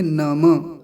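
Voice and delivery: नम with the final short "a" sounded, "na-ma"; teacher's careful two-syllable नम; Sanskrit नम. Shown as a demonstration. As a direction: say नम in two syllables, no breath after it. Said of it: "यहाँ संस्कृत है तो क्या बोलेंगे".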